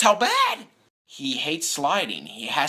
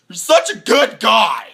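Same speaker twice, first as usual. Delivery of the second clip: louder, higher, slow shout; exasperated shout; 8 semitones higher, 10 dB louder, slower than usual. Real, not acted. Fan yelling about Luck's good nature, exasperated shout. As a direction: yell it in fond frustration.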